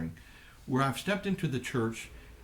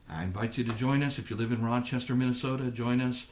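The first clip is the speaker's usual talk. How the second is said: normal talking voice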